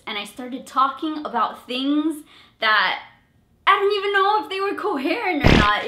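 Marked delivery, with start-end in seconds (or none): 2.6-5.9 high, loud, fast rambling; frantic rambling. Retold or imitated. Recalling her nervous rant to the interviewers, high and frantic. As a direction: rush through it in a flustered rant. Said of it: "I freaked out and I sounded like the weatherman".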